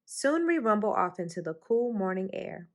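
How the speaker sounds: ordinary delivery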